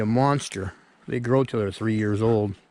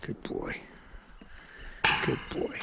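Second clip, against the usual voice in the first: whispered praise; 12 dB quieter than usual. Real, not acted. Praising the dog.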